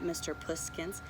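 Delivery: ordinary delivery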